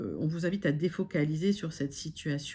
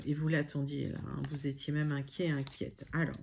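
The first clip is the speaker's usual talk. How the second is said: normal talking voice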